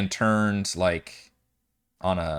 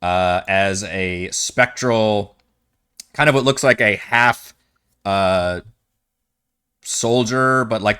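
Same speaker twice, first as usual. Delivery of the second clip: ordinary delivery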